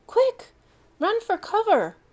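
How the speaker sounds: very high loud alarm; bellowed. Acted. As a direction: shout the warning to flee. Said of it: "Bronco bellowed".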